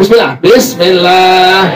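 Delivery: slow drawn-out "Bismillah". Shown as a demonstration. Teacher leading the class slowly in unison. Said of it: "Pelan-pelan".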